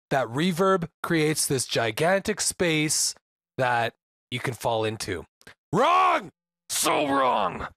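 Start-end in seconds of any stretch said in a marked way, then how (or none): 5.7-7.7 high, loud shout of wrong; emphatic shout. Acted. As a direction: yell it like a buzzer. Correcting viewers, mock outrage.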